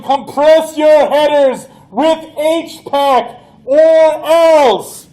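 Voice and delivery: loud, high, slow threatening yell; alien yelling. Acted. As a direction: bellow the threat like an alien commander. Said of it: "it starts yelling".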